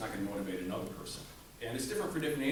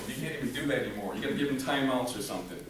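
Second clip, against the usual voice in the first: usual speaking voice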